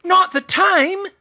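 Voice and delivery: very high, loud, slow shriek; furious aunt shrieking at Arthur. Acted. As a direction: shriek it back in outrage. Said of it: "Muriel shrieked".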